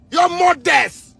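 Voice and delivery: high, slow, drawn-out angry yell; furious shout. Real, not acted. Cursing the officials who worry the youth, a drawn-out furious yell. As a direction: stretch every word out in pure rage.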